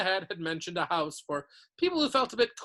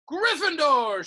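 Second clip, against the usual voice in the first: high, loud announcing shout; hat's shout; 10 semitones higher, 10 dB louder, slower than usual. A narrator's reading. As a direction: call the house out proudly to the hall. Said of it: "shouted the hat".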